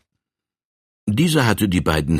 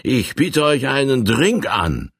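a lightly raised, swaggering colonel voice; a hint of a boastful frontier bully; brash colonel; 7 semitones higher than usual. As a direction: push the drink on him with swagger. Colonel's pushy offer, held voice.

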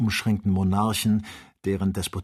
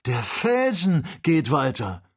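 high, slow cry of amazement; astonished exclamation; 6 semitones higher, 4 dB louder than usual. A narrator's reading. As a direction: draw it out in raised-pitch disbelief. Astonished cry at the moving rock. (erstaunter, gedehnter Ausruf)

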